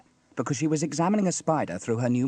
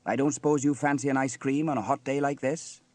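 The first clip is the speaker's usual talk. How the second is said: plain reading voice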